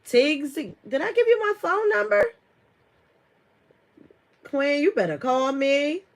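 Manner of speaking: high, loud excited demand; excited calling out. Real, not acted. Streamer urging a viewer to call her, excited. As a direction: exclaim it high and loud at a friend.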